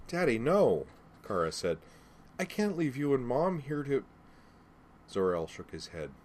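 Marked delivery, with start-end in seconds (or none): 0.1-0.9 put-on high, pleading young girl; distressed child's plea. Daughter protesting to her father, distressed plea. 2.4-4.0 high-pitched, pleading refusal; distressed child's protest. Acted. Daughter refusing to leave her parents, distressed protest.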